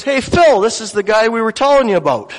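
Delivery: calling out. Retold or imitated. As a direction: call him over, loud and quick. Calls over to Phil.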